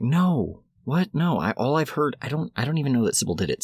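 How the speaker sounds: startled denial. Retold or imitated. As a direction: deny it in mock alarm. Acted startled denial by group.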